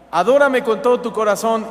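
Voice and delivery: booming divine command; commanding God. Acted. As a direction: proclaim it as God's order. God's commands voiced.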